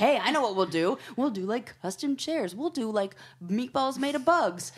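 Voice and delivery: put-on high, loud eager kid voice; excited kids. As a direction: play the giddy heirs pitching ideas. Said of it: "the kids are like".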